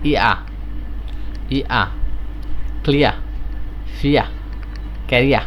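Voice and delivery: ক্লিয়া said with a gliding ia at the end; careful teacher demo of the ia vowel; ear sound ia. Shown as a demonstration. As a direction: glide ই into আ in ফিয়া and ক্যারিয়া. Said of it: "এর উচ্চারণ হলো ইয়া".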